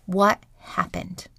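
quiet, slow inner question; softly asking yourself, slowly, what happened. Pretend. To herself after opening her eyes, quiet and slow. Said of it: "ask yourself".

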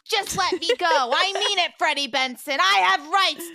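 high, loud, hammed-up wail; Neville wailing. Captive dragged into the ring, wailing in protest. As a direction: protest at full volume like a wronged whiner. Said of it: "Neville is wailing".

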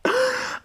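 real high-pitched burst of laughter; high squeal of laughter. Real, not acted. Laughing at a threatening post about Biden, a high squeal. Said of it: "I shouldn't laugh at death".

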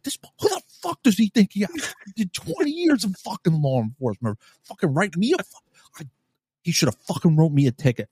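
high-pitched, worked-up venting; re-enacted tirade at no one in particular; berating rant. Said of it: "I'm, like, berating her".

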